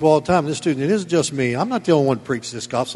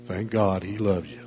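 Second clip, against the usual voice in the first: mock sweet preaching; 6 semitones lower, 6 dB quieter than usual. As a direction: lower and softer, a sugary mock of easy grace. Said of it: "It ain't gonna be".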